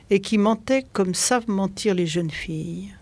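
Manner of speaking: ordinary delivery, nothing marked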